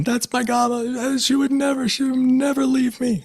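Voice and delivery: very high, loud tearful wail; crying woman. Retold or imitated. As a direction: cry it out like the granddaughter. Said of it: "the woman's crying".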